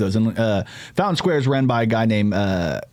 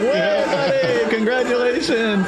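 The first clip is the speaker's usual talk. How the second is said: high, drawn-out fake cheer; mock congratulations; 14 semitones higher, slower than usual. Acting out everybody greeting the comic, mock gushing. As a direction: gush it slowly, way up in pitch.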